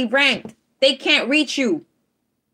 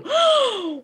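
sharp gasp; 13 semitones higher than usual. Real, not acted. Sees the card, overwhelmed.

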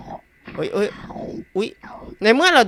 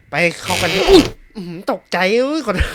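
startled; 3 semitones higher, 5 dB louder than usual. Real, not acted. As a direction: jump then laugh it off. Startled by sudden noise.

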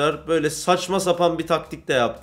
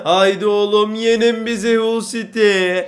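pleading shout; 5 semitones higher, 6 dB louder, slower than usual. Real, not acted. Begging Hull to beat them.

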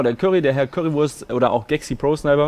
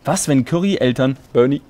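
low, hushed storyteller reading; serious bedtime-story narration; 6 semitones lower, 3 dB quieter than usual. Acted. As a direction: read the fanfiction out in a lowered, measured voice. Reads fanfiction aloud.

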